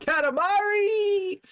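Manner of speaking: high, drawn-out cry of the game title; excited outcry. Pretend. Streamer hyping tomorrow's Katamari game, excited high cry. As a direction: squeal it out, long and high. Sudden cry of the game's name.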